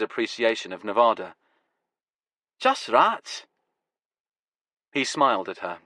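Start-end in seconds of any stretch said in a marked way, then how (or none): 2.6-3.5 put-on broad Glasgow accent, higher; full-throttle Glasgow accent. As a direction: Glaswegian grower answering dryly. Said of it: "his Glasgow accent now going full throttle".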